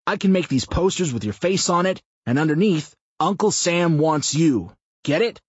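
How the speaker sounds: a foul-mouthed blowhard pitching an idea; crude loud man. A narrator's reading. Mouth pitches a rally to Sam, loud brash rough voice.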